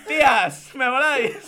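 laughing. Acted. Hammy reading, laughing.

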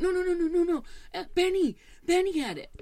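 put-on high-pitched child voice; protesting little daughter. Daughter blaming her sister for the knife, high childish protest. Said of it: "And she was like".

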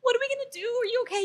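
very high, squeaky fretting; mock-tearful panic. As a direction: imitate frantic worry in a high, pleading voice. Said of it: "She was, like, crying".

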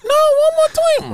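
high, loud mock plea, drawn out; pleading partner impression. Partner begs worn-out man for another round, high loud plea.

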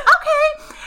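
Erin's high giddy okay; high squeaky okay. Retold or imitated. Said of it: "So she's like".

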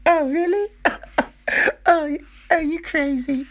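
very high put-on female voice; squeaky-high mock flirting, played for laughs; imitated smitten female firefly. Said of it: "there's that one be like".

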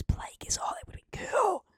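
breathy excited whisper. Real, not acted. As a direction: hush it like a secret. Excited secret fan theory aside.

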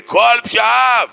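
high, loud, drawn-out proclamation; exclaimed quotation. Shown as a demonstration. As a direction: cry it out slowly as the clinching proof. Quotes Talmud as triumphant proof.

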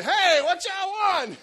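put-on high, loud, drawn-out kid voice calling out; kid at the door. Preacher imitating a kid at the door, loud and high. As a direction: holler like a kid yanking open the door.

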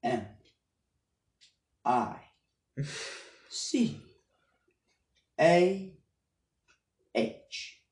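letter by letter, long pauses between; very slow spelling. Shown as a demonstration. Podcaster spelling his misread name for listeners, very slow. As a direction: spell it out painstakingly slowly. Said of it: "say it very slow".

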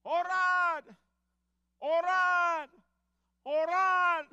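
screamed high and loud, over and over; wife screaming to pray in the car, frantic high shout. Retold or imitated. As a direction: yell it in panic from the passenger seat. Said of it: "mi esposa empezó a gritar".

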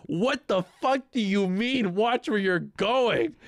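high, slow, drawn-out outraged yell; imitated angry screaming. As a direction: mimic a furious friend, stretching the words. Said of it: "he just started screaming".

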